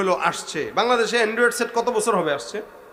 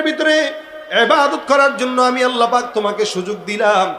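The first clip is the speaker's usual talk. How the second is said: raised, loud summoning sermon voice; full-force preaching; 5 semitones higher, 5 dB louder than usual. Acted. Allah summons the servant to judgement, full-force preaching. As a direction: call it out to the whole congregation.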